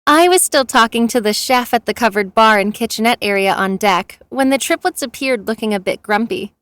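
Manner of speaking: usual speaking voice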